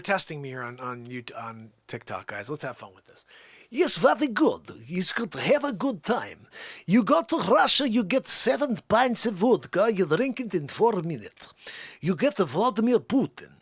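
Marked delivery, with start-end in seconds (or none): 3.7-13.5 thick, hammed-up Russian accent. Russian recounting vodka with Vladimir Putin, Russian accent. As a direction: tell it as a comic Russian. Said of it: "when you do the Russian accent".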